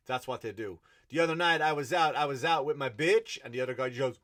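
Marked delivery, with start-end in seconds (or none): 1.1-3.4 loud, hammed-up rapper on stage; mock rapper. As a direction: belt it out like a parody rap verse. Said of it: "just stand behind you, hyping you up".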